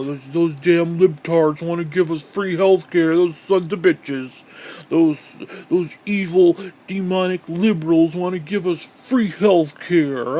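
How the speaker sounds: slow, emphatic mock ranting; sarcastic mock outrage. Mocking conservatives, slows into sarcastic outrage.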